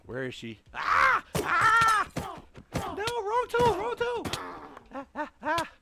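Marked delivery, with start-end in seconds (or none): 0.8-2.1 high shrill panic, yelling run away; panicked screaming. Real, not acted. Startled by a witch, fleeing. 2.8-3.8 high, slow panicked cry; panicked yelp. Real, not acted. Panic, wrong item selected. 3.8-4.3 high quick panicked repeat; panicked yelp. Real, not acted. 4.9-5.7 high shrill panic, yelling run away; panicked screaming. Real, not acted. Startled by a witch, fleeing.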